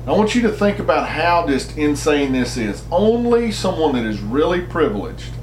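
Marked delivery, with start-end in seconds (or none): none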